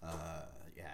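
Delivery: ordinary talk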